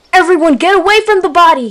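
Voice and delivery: high, loud, quick order; shouted. A narrator's reading. Leader warning the clan off the body, shouting quickly. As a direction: shout an urgent warning to everyone. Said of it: "he shouted".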